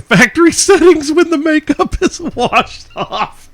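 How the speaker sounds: reading while breaking into laughter; cracking up mid-question; laughing. Real, not acted. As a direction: laugh through the punchline.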